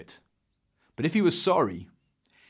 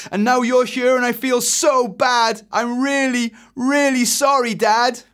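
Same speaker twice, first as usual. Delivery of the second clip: high, loud, frantic run-on outburst; blurted confession; 13 semitones higher, 8 dB louder than usual. Acted. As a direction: pour out the guilty confession at full pitch. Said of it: "blurted Eric".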